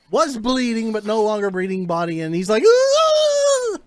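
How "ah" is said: loud, very high scream; freaked-out scream. Acted. Man seeing his companion's bleeding body, freaked-out scream. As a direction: let out a high shriek of panic. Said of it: "He starts freaking out".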